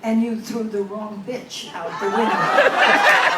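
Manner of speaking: loud, slow punchline, breaking into laughter. Acted. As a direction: land it big and slow. Punchline of Englishman's retort.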